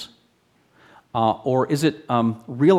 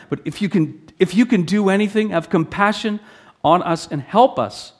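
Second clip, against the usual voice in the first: higher, pleading father begging for help; desperate plea; 7 semitones higher, 4 dB louder than usual. Acted. Father begging Jesus to heal his son, desperate pleading. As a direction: beg for compassion, voice climbing.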